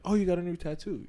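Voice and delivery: imitated woman coworker. Woman's retold interested remark.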